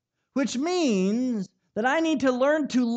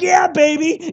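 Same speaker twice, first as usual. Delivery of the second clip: pumped dad; 5 semitones higher, 11 dB louder than usual. Retold or imitated. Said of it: "I'm like".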